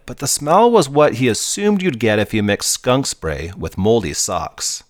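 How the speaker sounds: plain reading voice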